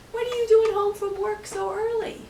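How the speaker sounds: high, loud surprised wife; wife's question. Acted. Una asking Finn why he is home, high surprised voice. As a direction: ask him why he's back.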